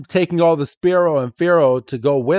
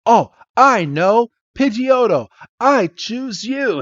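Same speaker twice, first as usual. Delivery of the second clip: heroic Ash; 9 semitones higher than usual. Retold or imitated. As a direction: proclaim it like a young trainer. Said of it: "He's like".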